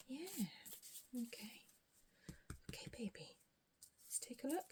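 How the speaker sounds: very soft near-whisper. Real, not acted. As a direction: hush it for the cat. Hushed words to the cat.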